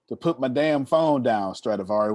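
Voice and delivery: normal talking voice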